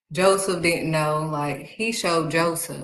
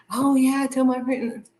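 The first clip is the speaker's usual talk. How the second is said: eager daughter; 7 semitones higher than usual. Retold or imitated. Daughter who talks too soon, eager. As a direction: imitate a daughter blurting it out.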